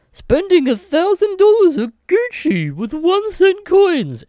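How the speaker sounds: high, loud, slow showing-off voice; mock rich guy. Mocking rich people paying in coins, hammed-up high shout. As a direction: ham up a smug rich guy's boast.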